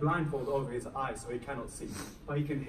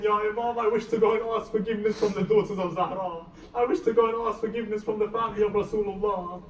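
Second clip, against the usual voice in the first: high, loud fervent entreaty; acted begging, pitched up throughout; impassioned retold plea; 6 semitones higher, 4 dB louder than usual. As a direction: plead urgently in a raised preacher's voice. Retold plea for permission to apologise.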